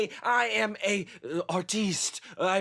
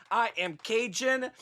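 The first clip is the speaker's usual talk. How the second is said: ordinary delivery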